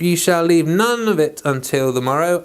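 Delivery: ordinary talk, nothing marked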